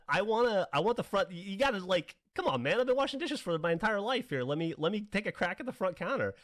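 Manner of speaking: high-pitched, exasperated mock plea; retold begging for a chance, pitched well up; pleading protest. Teenage dishwasher asks the manager for front counter, pleading.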